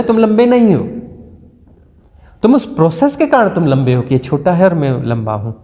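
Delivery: normal talking voice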